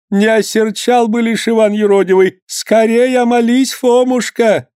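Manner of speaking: высоко, торопливо умоляя по-старушечьи; причитай в страхе, подгоняя; перепуганная старуха. A narrator's reading. (мать умоляет Фомушку молиться, в испуге)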